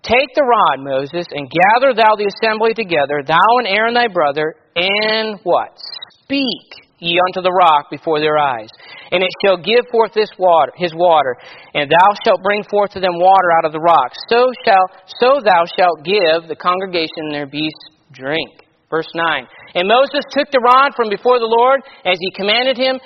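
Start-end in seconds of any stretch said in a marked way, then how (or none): 0.0-5.4 loud, measured King James reading voice; scripture reading. Acted. Preacher reads scripture aloud. 6.2-18.7 loud, measured King James reading voice; scripture reading. Acted. Preacher reads scripture aloud.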